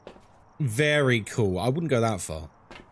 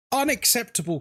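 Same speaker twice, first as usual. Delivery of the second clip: higher, jokey mock scolding of Kate; mock outrage; 8 semitones higher than usual. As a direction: pretend to be appalled, then let it drop. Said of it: "No, I'm only joking".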